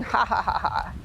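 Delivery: soft, smug chuckle of a king; king's chuckle. Acted. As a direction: chuckle quietly to yourself like a scheming king.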